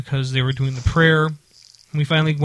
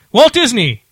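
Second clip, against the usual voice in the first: high, loud joke toast; mock toast shout; 8 semitones higher, 7 dB louder, slower than usual. Pretending to shout a toast at the ball. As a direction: bellow it out like a formal toast. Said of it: "I wanted to be".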